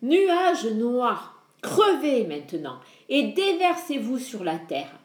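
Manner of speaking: commanding shout to the storm clouds. Acted. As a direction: cry out the command like a god of thunder. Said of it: "Il cria".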